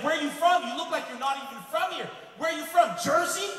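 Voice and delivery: loud, high mock interrogation; confrontational cop. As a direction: get in someone's face as the officer. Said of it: "he started getting in my face".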